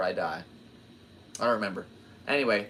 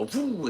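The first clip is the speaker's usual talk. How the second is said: higher woo boost sound; imitated whoosh; 11 semitones higher than usual. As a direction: swoop it up like a speed boost. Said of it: "it go".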